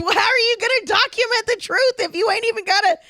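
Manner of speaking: high-pitched, incredulous ranting; mock exasperation. Real, not acted. Comic mocking how truth-seekers film things, exasperated. Mock outrage at grainy conspiracy footage.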